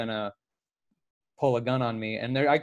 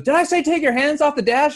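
much higher, louder barked cop order; an angry cop yelling at a driver who moved his hands; angry cop shout; 12 semitones higher, 6 dB louder than usual. Retold or imitated. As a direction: snap at him like an angry cop. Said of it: "and he goes".

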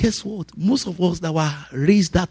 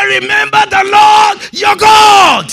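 high, loud, slow booming command; loud divine command; 14 semitones higher, 8 dB louder, slower than usual. Retold or imitated. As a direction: boom it like God's voice. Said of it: "He said".

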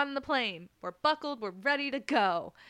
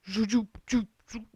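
whirring sound effect; 4 dB louder than usual. Retold or imitated. Imitating the plane's whirring below, zoop sound. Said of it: "sound effects are on point".

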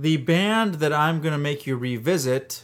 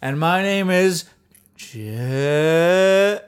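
announcer sign-off; 2 semitones higher, 4 dB louder, much slower than usual. Pretend. Drawn-out sign-off.

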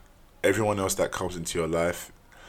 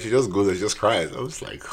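normal talking voice

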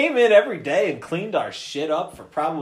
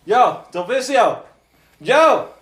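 louder, higher calls, the middle one slower; calling out; 5 semitones higher, 6 dB louder, slower than usual. Retold or imitated. Calling to Del Vicio through the smoke-filled bathroom, loud and high. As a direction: call through the door to a friend.

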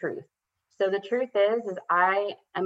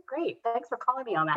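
a self-mocking "great" in a raised pitch; mock sarcastic; 6 semitones higher than usual. Mother retelling her wry reply to her daughter, high and sarcastic.

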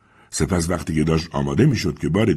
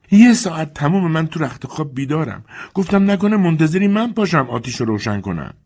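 put-on high, peevish old lady voice; complaining mistress; 10 semitones higher, 4 dB louder than usual. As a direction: grumble from the bed upstairs. Mrs Compson's voice.

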